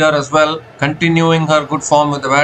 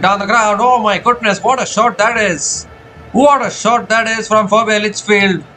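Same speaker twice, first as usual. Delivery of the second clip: higher, thrilled shot call; cricket commentator; 5 semitones higher than usual. Real, not acted. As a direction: marvel at the big hit. Big shot, live call.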